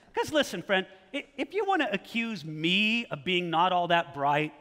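normal talking voice

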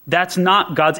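ordinary talk

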